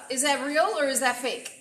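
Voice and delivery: normal talking voice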